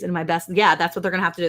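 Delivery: ordinary delivery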